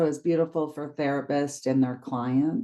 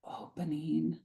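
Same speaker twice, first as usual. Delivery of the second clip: whisper; 12 dB quieter than usual. Acted. Meditation, heart opening image.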